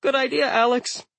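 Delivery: high, squeaky voice. A narrator's reading. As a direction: squeak it out nervously. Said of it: "I squeaked".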